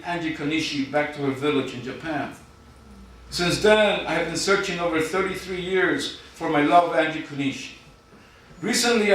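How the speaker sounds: deadpan spoken-word poem read to a crowd; poem recital. Acted. Poet reading his contest entry to the judges, mock-solemn recital.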